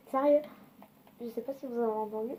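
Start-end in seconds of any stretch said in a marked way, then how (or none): 0.1-0.4 loud incredulous sérieux. Real, not acted. Incredulous exclamation.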